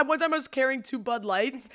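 ordinary talk, nothing marked

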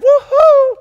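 very high, loud whoop; woohoo. Shown as a demonstration. Pastor whooping in the middle of the verse. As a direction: shout it out with joy.

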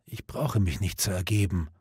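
a dying man barely holding his voice together; breaking voice. A narrator's reading. Dying Gawain refusing Lancelot, voice breaking. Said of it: "mit brechender Stimme".

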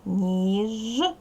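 Ніжка stretched as ніііжж, long gap, then ка; two syllables. Shown as a demonstration. Teacher showing two syllables to pupils, Ніжка drawn out and split. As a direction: draw out ніж with a buzzing ж, pause before ка. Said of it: "Два склади".

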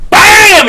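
sudden high loud bam; loud impact shout. Retold or imitated. Telling friends about the hit, loud bam. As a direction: shout the hit like a sound effect. Narrating a backhand to the groin.

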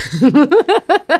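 a burst of high-pitched laughter; sudden squeaky giggle; laughs up high. Real, not acted. Laughs at own curse.

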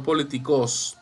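politi-CÓS, last syllable stressed; wrong final stress. Shown as a demonstration. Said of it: "no políticos".

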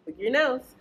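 ordinary delivery

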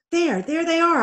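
high, loud relieved shout; excited cry. Acted. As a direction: call out spotting them. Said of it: "Ivan cried".

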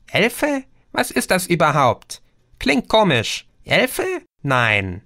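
high, puzzled little being; tiny creature voice. A narrator's reading. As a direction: squeak it like a tiny fairy, puzzled by the word. (hohe, verwunderte Wesen-Stimme)

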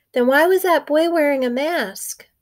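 put-on high, loud little-boy question; a little brother puzzled about a mask; curious little boy. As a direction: ask it like a wide-eyed small child. Little brother asks the babysitter.

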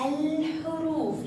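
"الحروف" with a long, high-held ل; pronounced lam. Shown as a demonstration. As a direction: stretch الحروف slowly, sounding the L before ح. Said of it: "لاحظوا هنا لو وضعنا هنا اللام".

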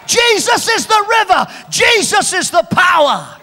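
loud, high preacher's cry, rising to a shout; full-force preaching. Acted. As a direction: whip the crowd into shouting praise.

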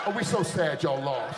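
low, slow, hammed-up fake sadness; mock sympathy. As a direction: pretend to be sorry while glad. Fake sympathy after loss.

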